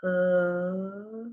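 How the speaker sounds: "uh" held long on its own; isolated vowel sound. Shown as a demonstration. Teacher rereading a word sound by sound, vowel drawn out. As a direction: stretch out "uh", the vowel in the middle of push. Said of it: "It's a vowel sound".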